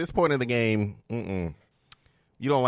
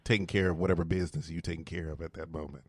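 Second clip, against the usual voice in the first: sexy voice; 5 semitones lower than usual. Shown as a demonstration. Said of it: "This is not my normal voice".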